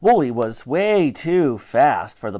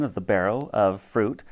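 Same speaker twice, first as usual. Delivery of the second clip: ordinary delivery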